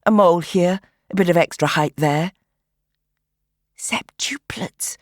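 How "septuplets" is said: slow breathy whisper; unvoiced drawn-out whisper. A narrator's reading. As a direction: whisper it in wonder. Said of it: "she whispered".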